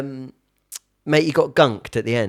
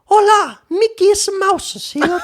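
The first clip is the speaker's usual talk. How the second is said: very high, loud mock-Spanish mouse voice; hammed-up falsetto with bad Spanish; Mickey Mouse; 4 dB louder than usual. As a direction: squeal a greeting as a Spanish cartoon mouse. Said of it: "I don't know Spanish".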